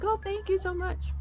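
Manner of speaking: high, choked-up thank you, retold; tearful thanks. Wife thanking the church friend for the envelope, tearful and high. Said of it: "I'm crying and I'm like".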